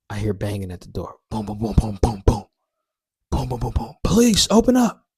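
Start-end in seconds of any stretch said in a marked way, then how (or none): 1.3-3.9 percussive booms, voiced like pounding fists; imitated door banging. Police pound on the door at night, mimicked as punchy booms. 4.0-5.1 loud, high-pitched commanding yell; police shout. Acted. Police at the door order him to open up, shouting loudly.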